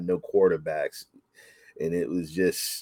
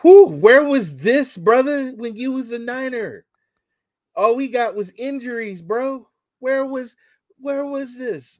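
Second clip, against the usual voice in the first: very high-pitched squeal of disbelief; exasperated complaint; 8 dB louder than usual. Acted. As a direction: whine way up high at an absent player. Mock complaint to a former player.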